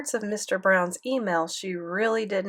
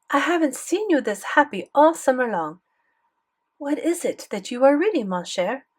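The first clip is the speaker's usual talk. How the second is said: put-on higher-pitched French accent; curious grandmother with a thick French accent; 5 semitones higher than usual. A narrator's reading. French grandmother asking her happy grandson, French accent. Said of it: "Grandmere had a thick French accent".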